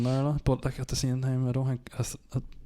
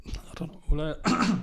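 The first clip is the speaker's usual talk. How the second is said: cracking voice, getting emotional; 5 semitones higher than usual. Real, not acted. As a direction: voice breaks while answering. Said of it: "Jesus, my voice cracked. Getting emotional".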